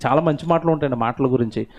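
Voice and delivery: ordinary delivery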